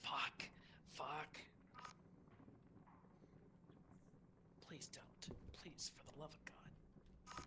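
hushed, breathy pleading; tense whisper. Real, not acted. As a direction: beg under your breath. Hiding from monster.

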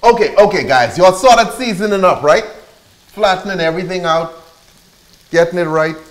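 loud, high-pitched calling. Real, not acted. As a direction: shout the instructions out to everyone. Calling out instructions to the viewers.

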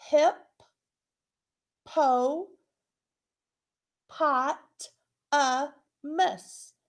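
"Hip, po, pot, a, mus" loud, each syllable on its own; syllables split apart. Shown as a demonstration. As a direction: count each of the five beats out loud. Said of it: "That's five syllables".

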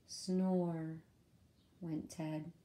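ordinary delivery, nothing marked

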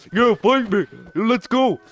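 high, loud fighting taunt; hyped mock challenge. Gamer squares up to a Pokemon, shouted high taunt.

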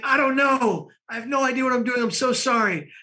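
high-pitched mock-frantic pleading; panicked apology. Flustered man apologizing to his date, panicked. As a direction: blurt it high, acting out helpless panic.

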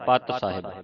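ਪਤ with a short a, not ਪਾਤ; quick low ਪਤ ਸਾਹਿਬ; short vowel. Shown as a demonstration. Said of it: "ਰਹਿ ਰਸ ਦੇ ਵਿੱਚ ਇਹ ਪਤ ਸਾਹਿਬ ਹੈ".

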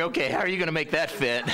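fast, high, laughing skeptic; a laughing, incredulous listener asking; mock doubtful listener. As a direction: voice the puzzled congregation, laughing. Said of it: "you're wondering".

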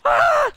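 sudden high scream of fright; startled shriek. Real, not acted. Gamer shrieking as an enemy jumps out, startled scream. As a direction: yelp in shock.

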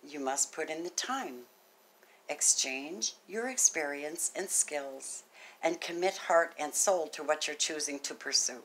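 soft measured reading of the message; oracle card reading. Acted. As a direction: read it gently like a spiritual message.

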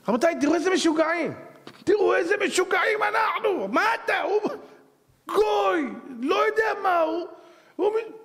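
fervent sermon outburst; preaching shout. Real, not acted. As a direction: rail against the crowd. Preacher rails at crowd following.

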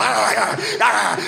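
high-pitched frantic dog noises; imitated dog howls. Dogs pulled out of little cages, imitated frantic yelping. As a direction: mimic sled dogs yelping. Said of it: "all these dogs being pulled out".

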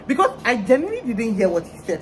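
ordinary delivery

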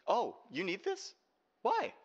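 high, sing-song mocking; mocking taunt. Taunting the boss with the stolen chip, mocking and high. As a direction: dangle it in front of them.

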